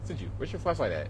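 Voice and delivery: ordinary talk, nothing marked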